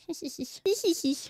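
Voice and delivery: high, quiet squeaky giggle. Real, not acted. Streamer giggling softly over a business card. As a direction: let a tiny high giggle slip.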